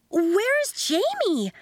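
put-on girl demanding where her friend went; loud girl. A narrator's reading. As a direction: draw it out, searching for Jamie. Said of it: "asked the loud girl".

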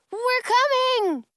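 loud, high, drawn-out call. A narrator's reading. Girl lets trapped boys know help comes, calling out. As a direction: holler it slowly so it carries. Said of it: "I call out between whistles".

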